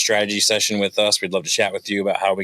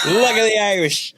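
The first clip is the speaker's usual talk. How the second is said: mock Irish accent; 12 semitones higher than usual. Podcast host clowning for his co-host in a hammed-up Irish accent. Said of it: "without the Irish accent or Scottish or whatever that was".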